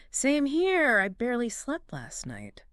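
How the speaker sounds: a tired reply that fades to a whisper; matching tired voice. Shown as a demonstration. Answering a tired friend in a matching tired voice. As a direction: yawn it out, worn out and agreeing. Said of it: "Matching tired voice".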